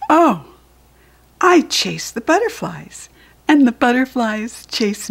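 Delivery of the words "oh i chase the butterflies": ordinary delivery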